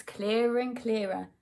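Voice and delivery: ordinary delivery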